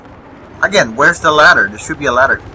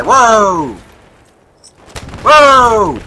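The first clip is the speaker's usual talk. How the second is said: loud, rising startled cry; startled whoa; 9 semitones higher, 6 dB louder than usual. Real, not acted. Gamer caught off guard, loud startled whoa. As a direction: shout in sudden alarm. Sudden surprise in game.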